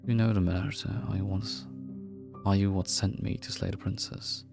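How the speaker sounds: normal talking voice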